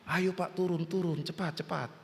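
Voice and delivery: hushed urging. Acted. First boat rescuer calls to man, softly acted urging. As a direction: imitate a rescuer urging, kept very soft.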